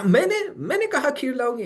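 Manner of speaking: indignant surprise. Retold or imitated. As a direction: sound shocked that anyone expects it. Guest denies promising the kheer.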